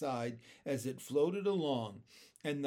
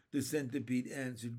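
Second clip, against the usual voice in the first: ordinary delivery, nothing marked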